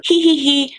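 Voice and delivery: high put-on "hee hee hee"; mock giggle. Mock giggle after imitating friends.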